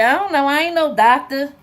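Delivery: loud, high, careless brush-off; dismissive father. Retold or imitated. Father carelessly brushing off his son's question, loud and dismissive. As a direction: toss it off like it's nothing.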